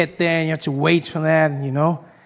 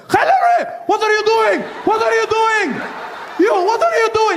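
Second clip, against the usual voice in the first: shocked guards shouting. Retold or imitated. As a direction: shriek it in disbelief. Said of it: "They were just like".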